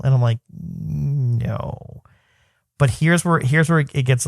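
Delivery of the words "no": soft, drawn-out reluctant no; reluctant answer. Retold or imitated. Dad breaking the Santa news to his son, soft and reluctant. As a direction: stretch it out softly, unwilling to admit it.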